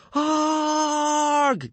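long, high, loud scream; panicked yell. A narrator's reading. Tribe yelling at the risen corpse, panicked yell. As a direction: yell out in sheer fright. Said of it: "yelled the rest of the tribe".

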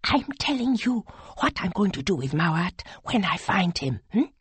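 hushed, menacing confidence; fierce whisper. A narrator's reading. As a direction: whisper it close, full of distressed fury. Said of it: "and whispered".

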